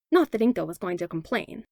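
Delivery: usual speaking voice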